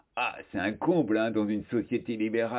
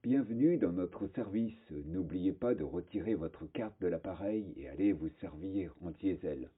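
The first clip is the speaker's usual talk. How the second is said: soft, polished announcement voice; like a recorded service-desk greeting; motorway hostess voice; 3 semitones lower, 7 dB quieter than usual. Acted. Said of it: "avec une voix d'hôtesse de société d'autoroute".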